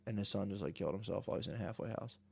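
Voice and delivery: dropped to a near-whisper, quick; lowering the voice on something grim; quiet and quick, telling a sad fact; hushed. Real, not acted. Telling of son's suicide.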